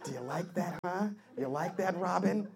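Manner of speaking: soft, teasing purr; mock seductive. Woman teasing her tied-up partner, soft and coy. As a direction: coo it softly to him.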